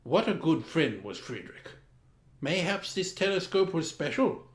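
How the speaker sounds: ordinary delivery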